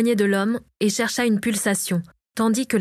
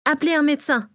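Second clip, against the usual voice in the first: higher-pitched call for help; urgent demand; 6 semitones higher, faster than usual. A narrator's reading. Natacha calls for a doctor for the explorer, urgent demand. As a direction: demand it firmly in a lifted voice. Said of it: "réclama-t-elle".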